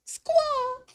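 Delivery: a high, loud eagle screech; high squawking bird cry. Pretend. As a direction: shriek like a bird of prey. Eagle cry after eagle boast.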